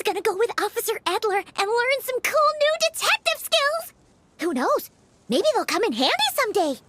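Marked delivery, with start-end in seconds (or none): none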